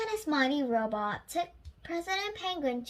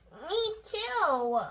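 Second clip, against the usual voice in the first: crying out; 6 semitones higher, 3 dB louder than usual. A narrator's reading. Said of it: "cried the first mate".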